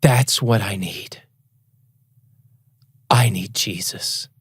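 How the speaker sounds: slow, hushed, moved; tearful sincere. Retold or imitated. Drunk man in tears answering the reader, slow and sincere. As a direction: say it slowly, deeply moved. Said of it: "he's like".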